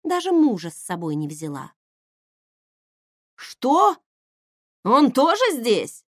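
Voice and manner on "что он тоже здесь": shocked disbelief. Acted. Shocked the husband came too.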